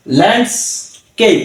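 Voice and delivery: ল্যান্ডস্কেপ slowly split into ল্যান্ডস and কেপ; slow ল্যান্ডস্কেপ with a gap before কেপ; two-syllable pronunciation. Shown as a demonstration. Teacher showing two syllables, ল্যান্ডস্কেপ slow and broken up. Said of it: "এটা আমরা দুই সিলেবলে ভেঙেছি".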